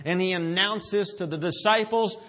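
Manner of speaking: ordinary talk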